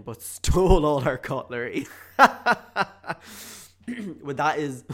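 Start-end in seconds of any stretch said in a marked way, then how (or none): 0.0-2.3 laughing. Real, not acted. Laughing while reading.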